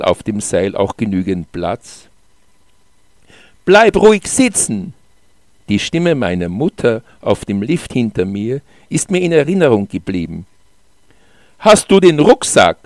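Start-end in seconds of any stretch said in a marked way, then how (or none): 3.6-4.9 high, loud call across a distance. A narrator's reading. Mother calling to child on the chairlift, loud high call. Mother calls from lift behind. 11.6-12.8 high, loud called-out question. A narrator's reading. Child asking mother behind on the chairlift, loud call. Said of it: "fragte ich laut".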